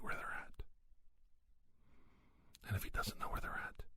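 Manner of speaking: hushed, mostly voiceless delivery; soft confiding whisper. Acted.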